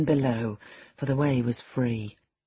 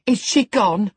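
higher, louder demand; urgent question; 6 semitones higher, 6 dB louder than usual. A narrator's reading. Housekeeper questions the boy about the girl, urgently. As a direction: press hard for an answer. Housekeeper presses Linton for news.

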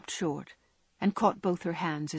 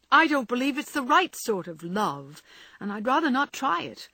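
decided; 6 semitones higher, 3 dB louder than usual. A narrator's reading. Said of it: "was the decided answer".

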